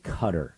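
"Qatar" said like "cutter"; "Qatar" rhyming with gutter; rejected pronunciation. Shown as a demonstration. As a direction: stress "Qatar" up front, as CUT-er. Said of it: "refuse to say Qatar".

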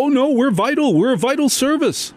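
high, whiny mock panic; whiny mock protest. Radio men whining at Congress, high mock panic. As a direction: squeal it like a scared radio exec. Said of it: "we go".

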